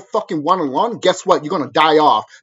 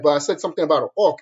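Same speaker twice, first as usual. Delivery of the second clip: ordinary talk, nothing marked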